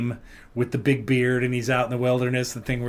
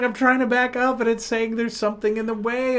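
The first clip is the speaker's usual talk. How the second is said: high-pitched, frustrated mock protest; irate daughter; 10 semitones higher than usual. Daughter explaining the car sensor to her parent, irate. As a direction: imitate her exasperated explanation. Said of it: "her body language is becoming more angry".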